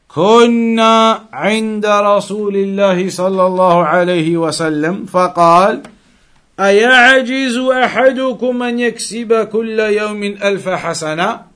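normal talking voice

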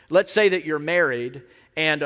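ordinary delivery

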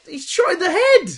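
high, loud disbelieving protest; loud squeal of outrage; incredulous outburst. Real, not acted. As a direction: protest it at the top of your register. Incredulous protest at film plot.

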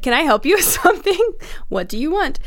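high, pointed offer of help; not very polite. Retold or imitated. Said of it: "probably not very politely said".

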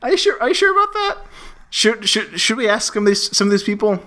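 high, squeaky doubting fan; mock incredulous fan. Host as pushy fan challenging Kevin Smith, high squeak. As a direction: needle him in a high mock voice. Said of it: "I'm Marcus Irving, customer".

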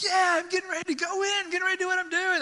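very high, eager voice; a squeaky, ready-for-action Q-tip; cocky Q-tip. Pretend. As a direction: gear up to go in. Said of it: "the Q-tip's like".

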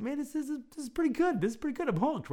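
excited. Retold or imitated. Thrilled newcomer answering his friend.

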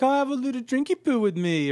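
high, tipsy mock Cockney slur; a lightly done drunk Cockney woman. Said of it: "she's talking like a Cockney".

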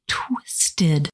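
usual speaking voice

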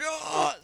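high strained groan of fake pain; clutch-the-chest groan, acted; mock heart-attack gasp. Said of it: "He starts going".

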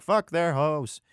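mock Canadian tough talk; Canadian accent. Six hundred dudes against one priest, Canadian accent. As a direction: put on a comic Canadian accent. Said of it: "I don't know why they're Canadian".

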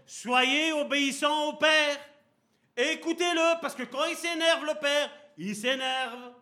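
high, loud, slow pulpit cry; full-force preaching. Acted. Preacher crying out to the congregation, high and loud. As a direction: lift the voice and call out to the church.

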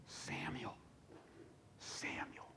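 very soft whispered call of a name; night-time whisper. Acted. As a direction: whisper it to a sleeping boy. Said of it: "in the middle of the night".

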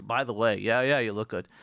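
low, offhand, bored compliment; dismissive compliment. Retold or imitated. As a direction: toss off the compliment without caring.

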